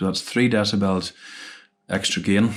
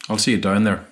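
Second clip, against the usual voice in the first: ordinary talk, nothing marked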